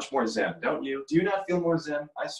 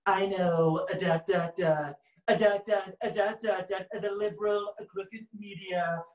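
halting, higher mock Trump voice; Colbert as Trump; 4 semitones higher, slower than usual. Griping about crooked media, a halting put-on Trump impression. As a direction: stutter along in a caricatured Trump voice. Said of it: "Colbert is more hush in his approach".

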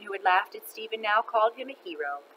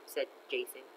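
ordinary delivery, nothing marked

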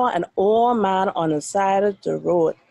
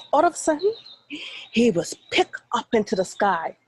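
plain reading voice

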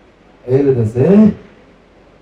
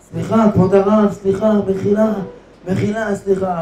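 high, fading, tearful pleas; tearful pleading; 5 semitones higher, 3 dB quieter than usual. Acted. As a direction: beg forgiveness through tears. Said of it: "בבכי גדול". (תחינה בוכייה ודועכת)

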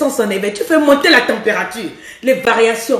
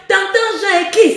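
mock wrong voice; 7 semitones higher than usual. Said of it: "Ne dis pas".

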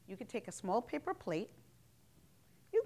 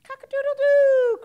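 high, very loud imitated crow; piercingly high, drawn-out final "doo"; rooster crow; 21 dB louder than usual. A narrator's reading. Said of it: "crowed the rooster".

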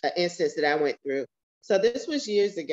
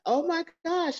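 much higher, startled exclamation; surprised gasp; 11 semitones higher than usual. Retold or imitated. Mother retelling her shock at the waitress's reply, pitch leaping high. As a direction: jump up in pitch, caught off guard.

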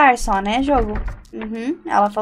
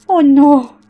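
panicked cry; 5 semitones higher, 8 dB louder than usual. Real, not acted. Ghost appears in horror game.

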